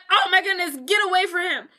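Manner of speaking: high, fussy mother voice; hammed-up protective mom; alarmed mother. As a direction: shoo her off in mock alarm. Alarmed mother shoos woman from son.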